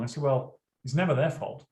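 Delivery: ordinary delivery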